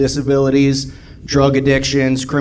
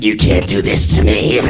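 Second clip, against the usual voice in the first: low, hammed-up werewolf growl; customer turning werewolf; 7 semitones lower than usual. Customer losing it at waiter, mock werewolf growl. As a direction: growl it like a monster transforming. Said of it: "a werewolf, that's what happened to her".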